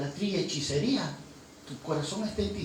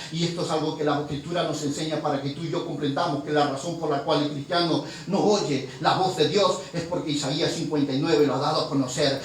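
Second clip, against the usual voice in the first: normal talking voice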